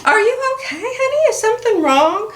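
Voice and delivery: husband's voice. Retold or imitated. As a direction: imitate the husband's concerned question, a touch mocking. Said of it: "You know, clueless".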